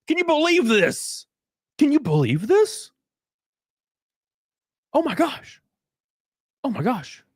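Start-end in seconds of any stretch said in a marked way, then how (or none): 0.1-1.3 high, astonished question; amazed and delighted; stunned disbelief. Real, not acted. 4.9-5.6 higher, breathless oh my gosh; delighted exclamation; excited gasp. Real, not acted.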